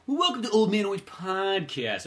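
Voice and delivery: high, drawn-out put-on singer voice. Co-host welcomes podcast listeners, mock rock-star voice. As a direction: open the show as a hammed-up rocker. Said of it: "gets all the [name]-y voice".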